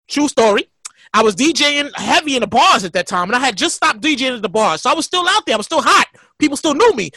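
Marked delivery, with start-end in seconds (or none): none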